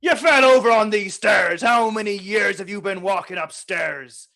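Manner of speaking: shouting insults at a fool; angry yell. Acted. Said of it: "so he's like".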